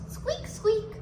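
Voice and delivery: high, tiny squeaking; hamster squeak. Shown as a demonstration. Teacher showing how hamster goes, high little squeaks. Animal sounds for children.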